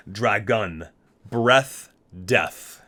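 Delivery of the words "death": normal talking voice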